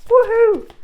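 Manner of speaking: high loud whoop; cheer. Real, not acted. Cheering her new favourite phrase, high loud whoop. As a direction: whoop with glee. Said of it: "cheering alone".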